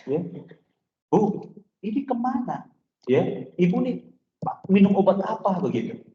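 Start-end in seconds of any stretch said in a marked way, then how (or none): 1.1-5.6 puzzled doctor. Retold or imitated. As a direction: ask like a baffled doctor. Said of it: "Akhirnya dokter ini tanya".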